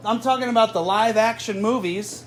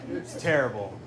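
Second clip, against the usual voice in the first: usual speaking voice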